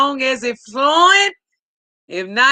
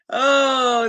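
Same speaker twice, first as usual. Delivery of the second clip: normal talking voice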